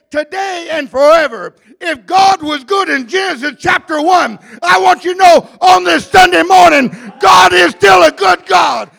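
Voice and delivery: loud, high preaching shout; full-force preaching. Real, not acted. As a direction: build to a high, loud sermon climax.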